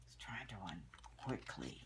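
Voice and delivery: soft whispered comment. Real, not acted. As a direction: drop to a whisper. Whispered aside while reading.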